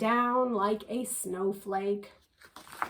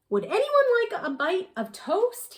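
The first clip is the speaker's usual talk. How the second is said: eager little owl; 9 semitones higher, 3 dB louder than usual. Acted. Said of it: "he said".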